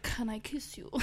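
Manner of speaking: hushed, breathy mock French accent; mock French accent, whispered to the listeners. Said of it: "Actually, he has a French accent".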